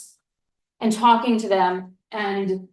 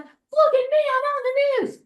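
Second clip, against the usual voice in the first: mocking show-off friend. Friend showing off her news clip, imitated in a high bragging squeal. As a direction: mimic a friend gloating about being on the news. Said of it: "she's like".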